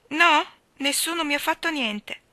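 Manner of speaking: put-on high, loud child's denial; protesting little boy. A narrator's reading. Davy denying he was punished, protesting little boy. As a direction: protest emphatically like a small boy.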